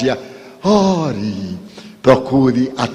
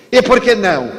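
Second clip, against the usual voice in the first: higher, louder challenging retort; retorting stranger; 7 semitones higher, 4 dB louder, faster than usual. Retold or imitated. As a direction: snap the question back at him. (estranho retruca mais alto e agudo)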